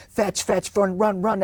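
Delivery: high, eager dog-park cheer; hyped-up owner. Retold or imitated. Owner revving dog at park, high excited calls. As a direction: pump the dog up like an overexcited owner.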